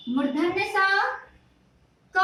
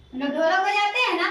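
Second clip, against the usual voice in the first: normal talking voice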